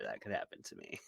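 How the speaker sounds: a breathy whispered aside; a hushed joking aside. Real, not acted. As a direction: drop to a whisper, half joking. Joking aside about herself.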